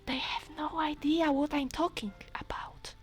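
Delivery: giggling whisper. Real, not acted. Player whispering about parents not understanding. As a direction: hush it conspiratorially. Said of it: "I hope my parents don't hear me".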